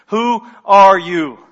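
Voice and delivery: slow, loud, pointed demand; confrontational, drawn-out question; sneering challenge. Acted. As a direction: challenge them word by word, loudly. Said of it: "This is a sneer".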